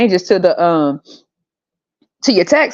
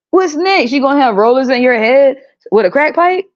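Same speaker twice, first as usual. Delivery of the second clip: high, agitated disbelief; exasperated; 6 semitones higher than usual. Real, not acted. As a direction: rant in outraged disbelief. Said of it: "exasperated rant at actress".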